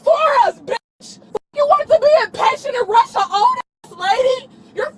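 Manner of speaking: crying while shouting at the officers in real rage; sobbing fury, words spat out; tearful angry yelling. Real, not acted. Arrested woman screaming at the police, crying and furious.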